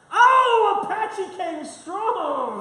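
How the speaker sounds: high, slow excited announcement. Real, not acted. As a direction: call out the winner with delight. Announcing the contest winner.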